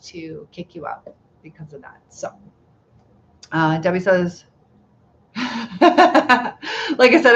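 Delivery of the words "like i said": laughing through slow words. Real, not acted. Streamer reading a viewer's comment, laughing through it.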